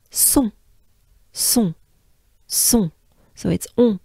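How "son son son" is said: "son" with a nasal French on. Shown as a demonstration. Teacher drilling the second syllable. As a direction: push "son" into the nose. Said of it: "the second part is".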